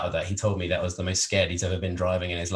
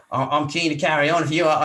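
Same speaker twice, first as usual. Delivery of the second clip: put-on higher, louder Australian accent, game and eager; a hammed-up Australian accent, keen for more; Aussie accent; 7 semitones higher, 5 dB louder, faster than usual. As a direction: play the gung-ho Aussie mate who'd keep driving. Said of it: "my accent's sucked".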